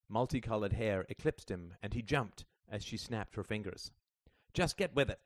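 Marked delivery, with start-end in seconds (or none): 4.5-5.2 raised, louder scolding; scowling. A narrator's reading. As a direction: order them about with a scowl. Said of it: "she scowled".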